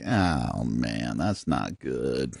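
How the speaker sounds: low, slow, retold dismay; mildly sad dismay. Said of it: "The saddest one was".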